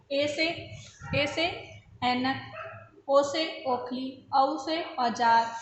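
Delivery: slow, drawn-out classroom recitation, word by word; slow vowel drill. Shown as a demonstration. Teacher drilling children on the vowels, slow and clear. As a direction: spell out each vowel and its example slowly for young pupils. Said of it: "teacher reads vowel examples".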